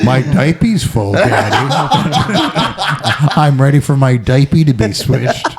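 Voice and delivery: mock toddler in a man's voice; lightly acted, grown-sounding baby; deep-voiced child. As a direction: announce it to Daddy with childish earnestness. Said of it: "hairless baby dick with that voice".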